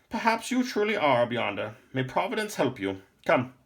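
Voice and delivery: high, loud old scholar voicing grave warning; stern old lore master. A narrator's reading. Lore master warns Jason of Maldor, in a high, loud old voice. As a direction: speak as an old lore master, fearful for the boy.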